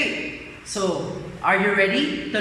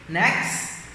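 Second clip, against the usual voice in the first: normal talking voice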